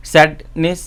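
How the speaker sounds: "Sadness" as two parts, sad, then নেস; split at the suffix. Shown as a demonstration. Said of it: "When there is a suffix". ("Sadness" দুই ভাগে আলাদা করে বলা)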